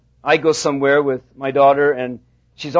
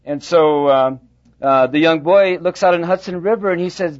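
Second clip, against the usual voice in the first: ordinary delivery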